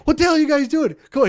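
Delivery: high-pitched, exasperated mock yell. Cousin catches the crew hiding from work, mock yelling. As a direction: imitate a cousin yelling at slackers. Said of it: "He would, like, yell at us".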